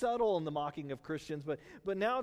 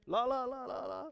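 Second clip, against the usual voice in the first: higher sing-song la la; childish la la; 6 semitones higher than usual. Retold or imitated. Pastor covering ears against God, childish sing-song. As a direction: drown him out like a kid. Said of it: "putting my hands to my ears".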